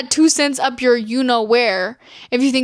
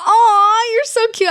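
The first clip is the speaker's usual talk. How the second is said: high, louder cooing; gushing; 8 semitones higher, 5 dB louder, slower than usual. Real, not acted. Host thanking a fan for kind words, gushing. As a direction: coo it high, melting at a compliment.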